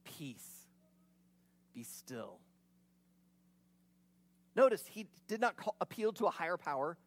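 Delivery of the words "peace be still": hushed, low, calm command; soft, low rebuke to the sea. Retold or imitated. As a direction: still the storm with a quiet word. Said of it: "and says".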